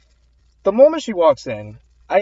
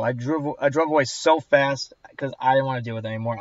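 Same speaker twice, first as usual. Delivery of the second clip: usual speaking voice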